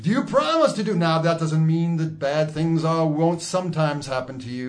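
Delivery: high preacher voice; preaching. Acted. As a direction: preach it to your flock. Mock preacher voice.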